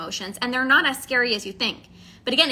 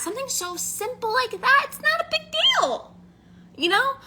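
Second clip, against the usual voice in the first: high light voice; 11 semitones higher than usual. Real, not acted. High emphatic aside.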